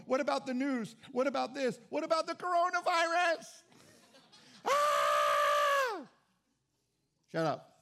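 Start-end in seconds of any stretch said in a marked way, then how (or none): 0.1-3.6 mock panic. Mocking a worried believer's panic. 4.6-6.1 loud, high hammed-up shriek of fear; a loud, hammed-up yelp of panic; mock panicked scream. Mocking frightened believer.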